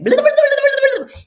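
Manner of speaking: squeaky, loud mock gibberish; mock caller gibberish. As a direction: imitate a caller rattling off French you can't follow. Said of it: "And they'd be like".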